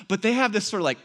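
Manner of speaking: ordinary talk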